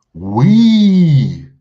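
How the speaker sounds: "we" belted high and loud; emphatic we. Real, not acted. Teacher stressing unity to the class, "we" belted loud. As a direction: punch out "we" like a rally cry.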